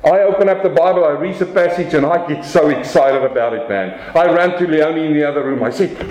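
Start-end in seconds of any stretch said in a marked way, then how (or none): none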